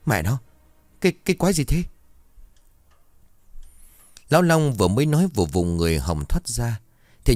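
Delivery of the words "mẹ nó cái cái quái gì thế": high-pitched, stammering shock; terrified outcry. A narrator's reading. Man seeing a slimy eyeless creature, high terrified cry. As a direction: cry out in horror, tripping on words. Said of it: "kinh hãi rồi rú ầm cả lên".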